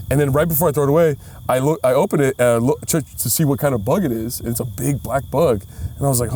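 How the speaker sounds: ordinary talk, nothing marked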